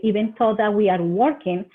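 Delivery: ordinary delivery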